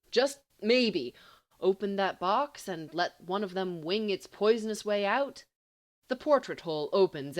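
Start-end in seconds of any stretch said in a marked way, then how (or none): none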